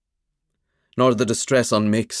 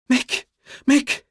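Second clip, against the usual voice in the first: frightened calling; 3 dB louder than usual. Acted. Frightened old woman calling.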